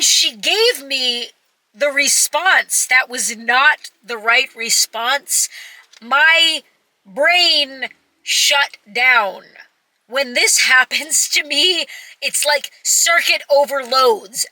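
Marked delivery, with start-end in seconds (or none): none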